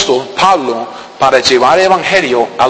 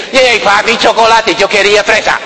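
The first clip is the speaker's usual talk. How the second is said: put-on high, whiny kid complaining; high spoiled-kid voice; spoiled child; 4 semitones higher than usual. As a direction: play a bratty child let down by the flavour. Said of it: "tan malcriados que dicen".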